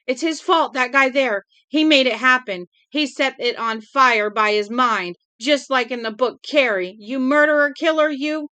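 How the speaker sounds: high, loud, hysterical accusation. Acted. Girl accusing Johnny of starting the fire, hysterical. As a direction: shriek the blame at him. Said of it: "talk in high, hysterical voices".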